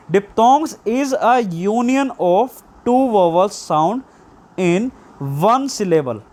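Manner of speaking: slow dictation. Shown as a demonstration. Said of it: "teacher dictates a definition".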